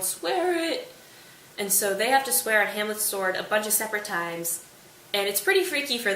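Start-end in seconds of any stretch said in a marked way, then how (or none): 0.0-0.9 mock ghostly call, drawn out slowly; yelling ghost. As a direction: intone it slowly like an offstage ghost. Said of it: "can still yell at people".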